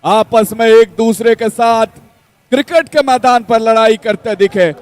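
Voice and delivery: announcer hyping the night; dramatic promo voice. Acted. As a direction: build suspense like a trailer. Dramatic promo intro over music.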